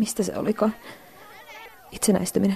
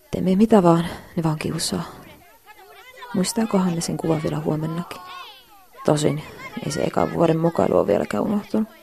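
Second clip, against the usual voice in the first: normal talking voice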